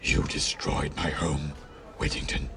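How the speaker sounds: deep, menacing rodent king; sneering Mouse King. A narrator's reading. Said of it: "sneered the Mouse King".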